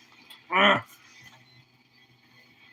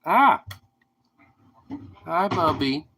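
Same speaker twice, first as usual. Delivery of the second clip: ordinary delivery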